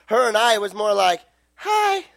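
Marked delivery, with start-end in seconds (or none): 1.6-2.1 put-on coy girl hello.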